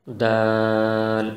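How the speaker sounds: دال with the alif stretched five counts, very slow; long Daal. Shown as a demonstration. Teacher shows the long alif in دال, very slow. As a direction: draw out the aa in دال for five beats. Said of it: "پانچ الف کے برابر کھینچ کے پڑھیں گے".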